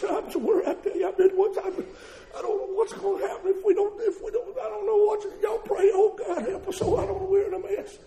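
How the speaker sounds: high, fretful mocking voice of a worried believer; high whiny worrywart, lightly laughing; mock worrier. Mimics a fretful churchgoer.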